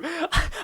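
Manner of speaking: short high squeal of laughter; real quick laugh; high giggle. Real, not acted. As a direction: giggle between words. Still laughing.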